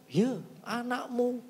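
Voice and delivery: high, slow mock yell of an alarmed father. Father shouts to mother that the son rebels, high slow mock yell. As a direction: pitch it up and drag it out, half-hearted panic. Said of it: "teriak ke mama saya".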